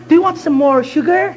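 high, laughing mock-English offer; polite Englishman. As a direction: play the courteous host, giggling. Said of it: "一个人跟旁边一个人". (高声带笑地模仿英国人客气问话)

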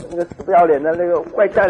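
name the villain through laughter; laughing mock prayer. Mock prayer spoken while laughing.

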